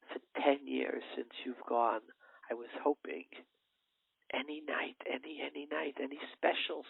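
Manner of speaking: mother softly welcoming her long-lost son; tender mother. Acted. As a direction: gently tell your son you waited. Mother tenderly answers returning son.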